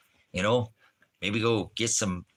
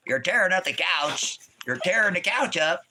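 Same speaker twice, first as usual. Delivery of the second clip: mock scolding dog; 9 semitones higher than usual. Real, not acted. Dog tearing up the couch.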